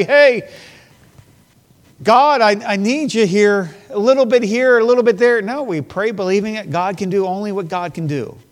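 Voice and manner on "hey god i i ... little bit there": loud, high mock call to God; imitating casual prayer. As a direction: call out a half-hearted prayer, raised and loud. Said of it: "we turn around and say".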